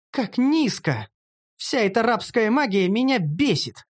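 high, angry female character voice; exploding indignation. A narrator's reading. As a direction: burst out in moderate outrage. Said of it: "взорвалась Карамелька".

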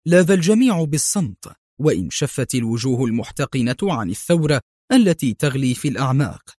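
usual speaking voice

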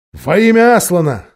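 loud, resolute declaration; solemn invocation. A narrator's reading. Travellers cutting the ropes, loud solemn invocation. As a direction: proclaim it as you act. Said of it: "произнесли они".

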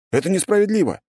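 high indignant whine; protest, wounded; whiny protest. Acted. Boy protests.

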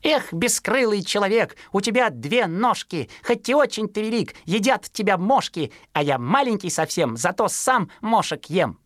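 bragging chick's verse. Acted. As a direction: chant it proudly like a young sparrow. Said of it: "распевал стихи".

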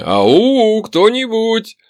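loud, high drawn-out call; hollering. A narrator's reading. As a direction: call out to whoever might be around. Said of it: "продолжил я орать". (громко звать, аукая)

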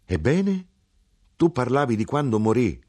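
high insistent mother, then settling; mother pushes him to go on; pressing mother. Acted. Mother to son, pressing high.

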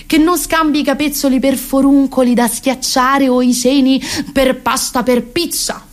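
normal talking voice

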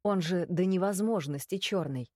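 ordinary delivery, nothing marked

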